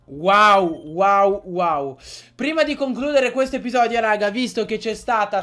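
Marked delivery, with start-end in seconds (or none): 0.1-2.0 loud wows, fading away; amazed wow. Real, not acted. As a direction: gasp at the twist. Amazed by the story.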